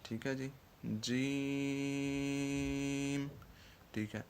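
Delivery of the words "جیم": جیم held long over five beats, slow and steady; drawn-out letter. Shown as a demonstration. Teacher showing students how to stretch جیم, very slow. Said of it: "پانچ حرکات کے برابر کھینچ کر پڑھنا ہے".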